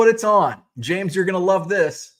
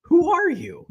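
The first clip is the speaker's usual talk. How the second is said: playful disbelief at his wife; teasing his wife, hammed-up surprise; mock astonishment; 3 semitones higher, slower than usual. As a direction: pitch shooting up on who are you. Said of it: "And I'm like".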